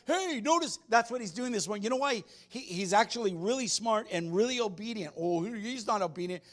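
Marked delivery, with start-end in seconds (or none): 0.1-0.8 high-pitched excited dog voice; playful pet voice, pitched way up; eager dog Nija. Acted. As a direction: pipe up like an attention-hungry dog. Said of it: "and he's like".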